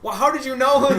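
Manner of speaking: high, loud mock disbelief; incredulous retort. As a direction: act baffled, breaking into a laugh. Retelling his baffled reply, cracking up.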